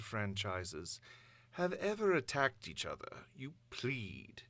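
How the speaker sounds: lightly voiced scornful tyrant; a hint of a cold ruler lecturing the nations; understated contempt for the listeners' hopes; villain monologue.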